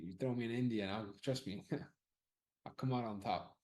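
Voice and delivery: usual speaking voice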